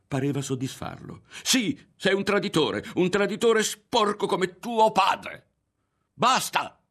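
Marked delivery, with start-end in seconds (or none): 1.4-5.5 very high, loud, drawn-out yell; furious yelling. A narrator's reading. Berto insults Ario and his father, furious yelling. 6.1-6.8 high, loud one-word outburst; outraged yell. A narrator's reading. Ario snaps at the insult to his father, yelling. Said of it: "urlò Ario".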